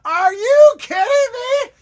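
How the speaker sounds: ordinary delivery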